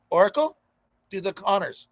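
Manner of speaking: ordinary talk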